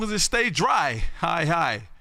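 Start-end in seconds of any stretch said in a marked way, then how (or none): none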